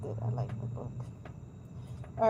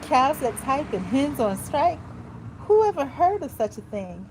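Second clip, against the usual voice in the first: indignant disbelief; 5 semitones higher, 5 dB louder than usual. Acted. Farmer Brown's outraged disbelief.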